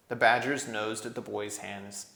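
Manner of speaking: ordinary delivery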